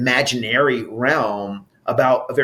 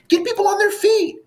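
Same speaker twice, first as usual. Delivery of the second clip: rousing call. Real, not acted. Energetic call to action.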